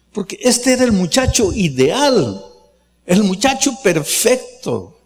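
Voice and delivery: high, emphatic praise, each trait lifted; rising admiration. Real, not acted. Pastor extols the model youth leader to the congregation, rising.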